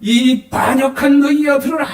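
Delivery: furious Moses. Acted. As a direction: Moses losing his temper, drawn out. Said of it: "머리가 그냥 팽팽 돌아가는".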